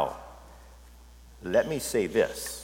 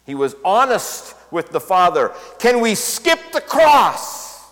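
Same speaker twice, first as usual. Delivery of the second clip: fervent preaching; 10 semitones higher, 10 dB louder than usual. Real, not acted. Pastor pressing his church to be honest with God, fervent. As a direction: preach it with full fervor.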